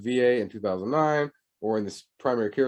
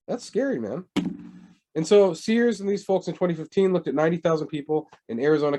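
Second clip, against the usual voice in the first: ordinary talk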